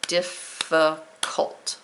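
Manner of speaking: Difficult drawn out slowly as dif-fi-cult; sounded-out syllables. Shown as a demonstration. Teacher modelling for students: Difficult stretched syllable by syllable. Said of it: "Both of them have three syllables".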